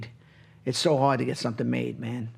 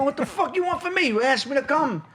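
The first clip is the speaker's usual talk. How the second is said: much higher, mock exasperated; exasperated retort; 10 semitones higher than usual. [name] scolds his friend, hammed-up exasperation. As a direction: throw it back at him, fed up.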